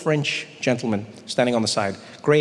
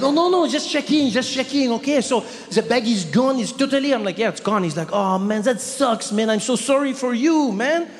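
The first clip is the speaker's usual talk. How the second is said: sympathetic clerk; 8 semitones higher than usual. Retold or imitated. Paris clerk to the comedian about lost luggage, high overdone sympathy.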